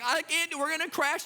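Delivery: high-pitched, hammed-up alarm; mock panic. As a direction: play a pilot losing control. Dad faking a crashing rocket.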